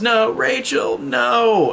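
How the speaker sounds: high-pitched mock scream of horror; retold scream. Jamie finds Rachel's body, imitated scream. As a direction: imitate a child's scream, held back in volume. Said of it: "where she screams".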